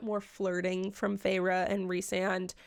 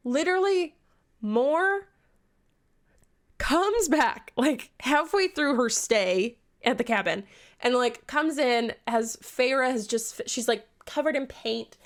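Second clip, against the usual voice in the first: louder, bursting with the story; loud excited telling; 6 dB louder than usual. Real, not acted. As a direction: blurt it out excitedly.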